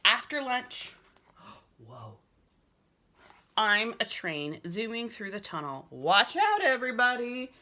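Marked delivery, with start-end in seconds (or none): 1.4-2.2 lower, soft breathy whoa; boy amazed after lunch; hushed amazed whoa. Acted. Excited discovery. 5.9-7.5 higher, louder call; playful warning call. Acted. Train boy warning.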